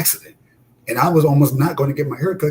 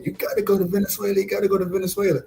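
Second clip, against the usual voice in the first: higher-pitched quote of an urging woman; imitated female barber; 6 semitones higher, faster than usual. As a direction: lightly voice her urging, pitched up. Quoting the woman urging him.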